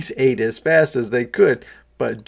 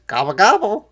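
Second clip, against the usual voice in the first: loud turkey gobble; 8 semitones higher, 7 dB louder, faster than usual. A narrator's reading. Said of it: "said the turkeys".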